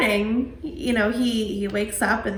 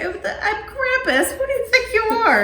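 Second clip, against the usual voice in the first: high, hammed-up jeering with laughter; mock a foe, much higher and half laughing; mocking villains; 12 semitones higher than usual. Villains laughing at the pleading kid, mocking. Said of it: "they all just kinda start laughing".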